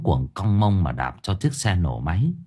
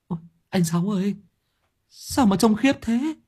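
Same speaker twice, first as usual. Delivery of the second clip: high, small squeak of dismay; stunned sidekick; 11 semitones higher than usual. A narrator's reading. Quang reacting to his friend's swelling, stunned.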